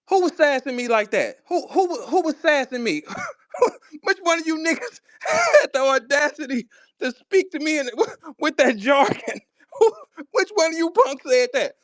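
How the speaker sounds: high-pitched indignation broken by laughs; laughing mock outrage. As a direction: demand the culprit while cracking up. Mock outrage at the robotic talker.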